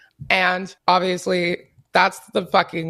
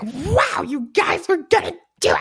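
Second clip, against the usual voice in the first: high squeaky toad voice; little toad voice; 8 semitones higher than usual. Retold or imitated. Enthusing to friends in a squeaky toad voice. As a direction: chirp it like a tiny cartoon toad. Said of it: "I don't know what the fuck that voice was".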